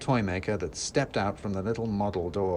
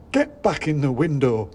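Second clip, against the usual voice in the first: louder, higher little shopkeeper scolding; angry shopkeeper; 5 semitones higher, 7 dB louder than usual. A narrator's reading. Red-faced shopkeeper ordering his soldiers back, loud. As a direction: scold like a flustered tiny man. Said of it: "quite red in the face".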